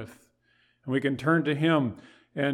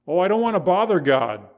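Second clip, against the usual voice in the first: mock pious excuse; 4 semitones higher, 8 dB louder than usual.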